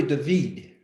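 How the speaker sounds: David said "da-VEED", stress on the second syllable; correct name pronunciation. Shown as a demonstration. Host correcting a participant's name, David as "da-VEED". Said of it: "It's really David".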